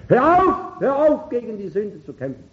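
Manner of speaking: impassioned preacher's outcry; urgent exhortation. Real, not acted. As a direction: implore them to stop. Preacher urgently exhorts congregation to stop.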